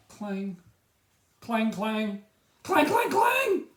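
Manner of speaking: put-on a boy ringing his nervous bell, climbing clangs.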